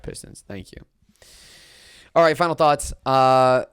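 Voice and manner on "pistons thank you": sarcastic thanks. Real, not acted. Sarcastic thanks to the losing team.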